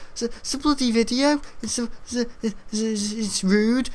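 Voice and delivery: high, halting put-on voice; squeaky, hesitant schoolboy protest, re-enacted; imitated sheepish pupil. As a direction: stammer an excuse to a strict teacher. Pupil stammering excuse to strict teacher.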